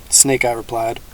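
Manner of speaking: usual speaking voice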